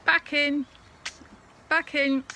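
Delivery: loud call across the field; calling to the horse. Acted. Farmer calling his horse in at dusk, loud. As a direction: shout it out to the horse like to a brother. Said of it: "one talks to the horse as to a brother".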